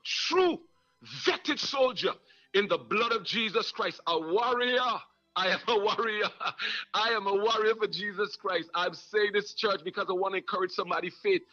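rising, fervent sermon cadence; preaching. Acted. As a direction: preach it to the congregation with fire. Preaching at full force.

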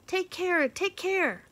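very high, loud bird cry; blue jay warning. Acted. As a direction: shriek it like a jay from the treetops. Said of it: "cawed a warning".

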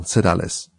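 plain reading voice

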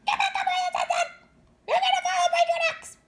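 a panicked mother bird yelling at her chicks; rapid high shrieking scold; frantic scolding. Acted.

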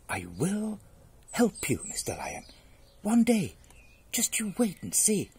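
high little mouse voice; remembered mouse promise. Acted. Mouse remembering promise to lion, high and earnest.

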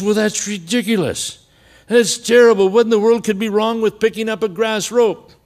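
high, loud mock outrage at the news; mock indignant. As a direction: act scandalised on his behalf. Friend indignant.

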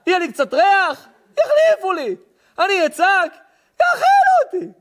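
put-on high-pitched baby's reasoning, rising to a squeal; scheming baby. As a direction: play the crafty baby, ending in a shriek. Voices a scheming baby's thoughts. (קול גבוה של תינוק ערמומי)

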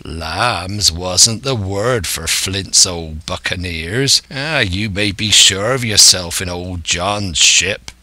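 slow, rolling West Country pirate voice; drawn-out old sea-dog talk; old pirate schemer, cajoling. Acted. Silver sweet-talking the lad aboard, as a cajoling pirate.

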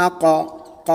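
"আক" বলে শেষের "ক" আলাদা করে প্রতিধ্বনির মতো ধাক্কা দিন; শেষ অক্ষর "ক" কে বাউন্স করিয়ে দেখান; কলকলা উচ্চারণ. Shown as a demonstration. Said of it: "লাস্টে যে একটি উচ্চারণ". (শিক্ষক শেষের "ক" টেনে কলকলা দেখাচ্ছেন)

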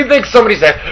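ordinary talk

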